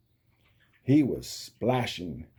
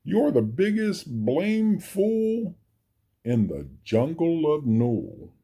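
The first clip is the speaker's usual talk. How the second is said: taunting kangaroo; 5 semitones higher than usual. Acted.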